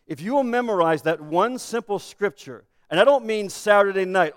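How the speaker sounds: usual speaking voice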